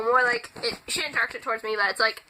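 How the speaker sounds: ordinary delivery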